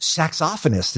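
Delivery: SAXOPHONIST stressed on the second syllable, "sak-SOF-onist"; British pronunciation. Shown as a demonstration. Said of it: "is a word here".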